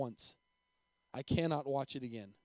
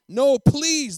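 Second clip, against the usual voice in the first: very loud, high desperate plea; begging; 9 semitones higher, 14 dB louder than usual. A narrator's reading. Former rebel begs for the principal, loud pleading. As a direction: cry out, begging for it to end.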